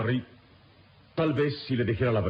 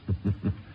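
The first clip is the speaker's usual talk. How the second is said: bitter laugh; 3 semitones higher than usual. Acted. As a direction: laugh at the idea of a defence. Calimán scoffs at defending himself.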